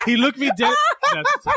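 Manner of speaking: high squeaky laughter through the words; cracking up mid-sentence; words dissolving into giggles; laughing. Real, not acted. Laughing while retelling.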